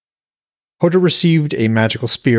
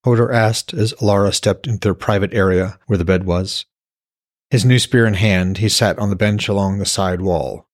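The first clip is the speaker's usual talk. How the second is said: steady storyteller reading aloud; calm novel narration; story narration; 2 semitones lower than usual. A narrator's reading. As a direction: read the chapter like an audiobook. Author reads novel aloud.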